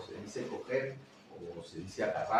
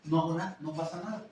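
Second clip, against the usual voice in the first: normal talking voice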